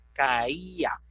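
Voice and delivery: CAÍA as three beats, ca-í-a; CAÍA with a hiatus, í kept apart from both a's; three syllables. Shown as a demonstration. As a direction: stress the í in CAÍA. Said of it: "emitimos tres emisiones de voz".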